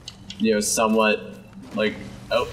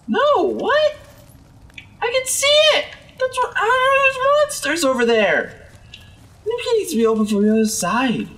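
much higher, squeaky cartoon voice; 10 semitones higher than usual. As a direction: squeal it like a tiny cartoon critter. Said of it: "that voice really screwed with me".

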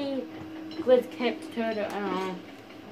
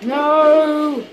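high, loud, drawn-out cry; dismayed yell; 4 semitones higher, 8 dB louder than usual. Real, not acted. Gamer losing the fight, dismayed yell. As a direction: wail the word out long.

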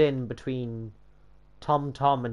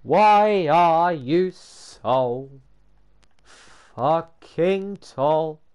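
loud, high, stretched-out wail; exasperated; 3 semitones higher, 6 dB louder, much slower than usual. Real, not acted. Protesting at the model being too tall, exasperated. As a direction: drag each word out in protest.

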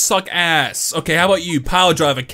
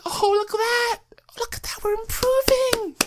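high mock delight; 5 dB quieter than usual. Real, not acted. Progress going up.

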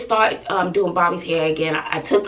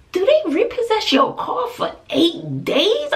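incredulous question; 11 semitones higher than usual. Retold or imitated. Disbelief at eight days.

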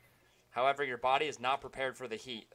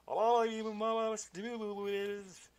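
high, very slow warbling ghost wail; ghost voice again; 9 semitones higher, much slower than usual. Acted. Ghost retrying his message, high warbling wail. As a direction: quaver the words like a spirit. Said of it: "I can do a better ghost voice".

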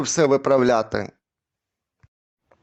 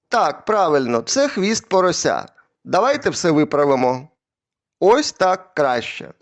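ordinary talk

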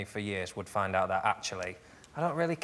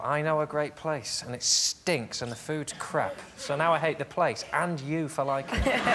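mock bitter rant; 3 semitones higher, slower than usual. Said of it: "How was that your anecdote?".

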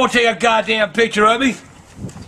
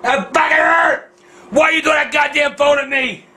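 indignant shout, pitched up at first; pained angry yell; 2 semitones higher than usual. Real, not acted. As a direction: holler in hurt outrage. Hit by thrown phone.

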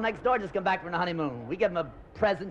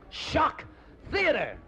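spooky announcer; 7 semitones higher, much slower than usual. Acted. Announcing the show title.

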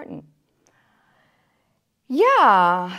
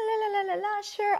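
airy waitress; 12 semitones higher than usual. Retold or imitated. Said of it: "she was like".

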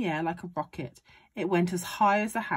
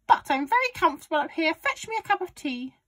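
high, fast granny voice, raised; bossy grandma; 11 semitones higher, 6 dB louder, faster than usual. A narrator's reading. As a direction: order the boy about as Grandma. Grandma ordering from the roof.

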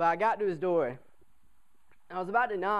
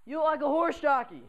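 mocking upperclassman; 9 semitones higher, 5 dB louder than usual. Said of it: "They'd usually tell me".

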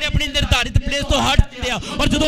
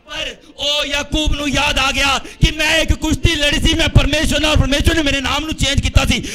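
raised sermon building to long shouted final words; preaching to a climax; 3 semitones higher, 4 dB louder than usual. Real, not acted. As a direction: drive the story up, then stretch the ending out.

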